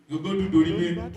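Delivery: ordinary talk, nothing marked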